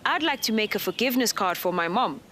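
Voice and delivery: ordinary delivery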